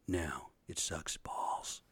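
slow, breathy whispered aside. Real, not acted. Telling old framers about roofing, whispered slowly. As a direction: drop to a slow whisper for the punchline.

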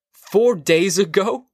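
high, slow rising question; incredulous. Acted. Husband hears his wife forgot for days, incredulous. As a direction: draw it out in rising disbelief. Montag shocked at the delayed news.

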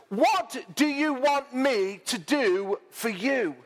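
high, slow, rising preaching voice; dramatic scripture reading. Acted. Preacher reads Mark ten to the congregation with rising fervour. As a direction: read it out like a fervent preacher.

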